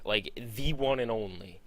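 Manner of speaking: usual speaking voice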